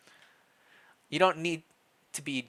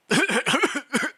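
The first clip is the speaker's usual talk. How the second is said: throat-clearing hacking cough; acted cough; 8 dB louder than usual. The injured heir clearing a sore throat, acted cough. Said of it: "You pause to clear your throat some more".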